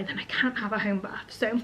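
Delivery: normal talking voice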